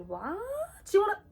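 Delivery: high, loud, incredulous squeal; high loud incredulous retelling; shocked disbelief. Retold or imitated. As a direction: act out total disbelief at the news. Shocked retelling of fight threat.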